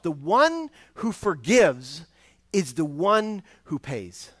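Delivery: slow, weighty "The one who forgives is the one who pays"; slow emphatic stress. Real, not acted. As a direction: land each word slowly, punching "one". Sermon's key line, slowed.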